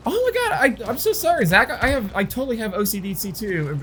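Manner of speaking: high, breathless-with-sympathy imitation; gushing coworker. Coworker chiming in to relate, high mock-sympathetic gush. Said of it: "she chimes in, and she's like".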